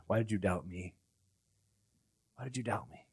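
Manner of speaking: very soft, low reproach; hushed questioning. Acted. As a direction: ask it almost under your breath. Acts Jesus gently asking Peter.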